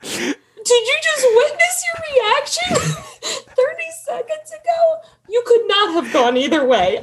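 high, laughing incredulous jab; laughing disbelief. Retold or imitated. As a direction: tease him in disbelief. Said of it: "I was like".

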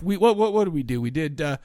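ordinary talk

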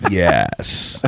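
low, heavy, solemn voice; deep voice. Shown as a demonstration. Host voicing all Americans backing Trump, in a deep voice. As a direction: drop the voice deep and steady. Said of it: "I'll do it in my deep voice".

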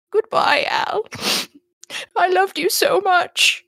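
high-pitched, hammed-up weepy goodbye; tearful farewell. Owner saying goodbye to her dead dog, high and weepy. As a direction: draw out the last words, high and tearful.

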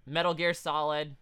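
ordinary delivery

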